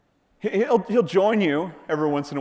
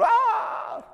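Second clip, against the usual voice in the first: high short cry of fright; mock scream. Disciples shrieking at the ghost on the water, mock fright. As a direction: yelp like a scared disciple.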